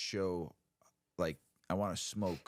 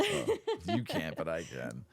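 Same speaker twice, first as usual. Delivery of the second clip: teasing laugh; 3 dB louder, slower than usual. Real, not acted. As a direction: tease through a laugh.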